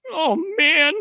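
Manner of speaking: high, loud yelping cry; intern getting hit. Retold or imitated. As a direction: yelp it out as you're blasted from the sky. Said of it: "I gotta do an impression of an intern".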